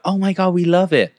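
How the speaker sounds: high-pitched mock enthusiasm; retold excitement, pitched well up; imitated delight. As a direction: gush like a delighted onlooker. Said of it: "these Mexican people and they're like".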